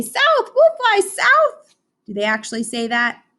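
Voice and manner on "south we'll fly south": high, slow honking goose imitation; geese voice. Teacher quoting the geese, high honking voice. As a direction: honk like the geese again. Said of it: "they say".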